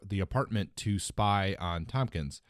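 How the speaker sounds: ordinary delivery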